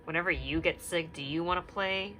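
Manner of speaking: ordinary talk, nothing marked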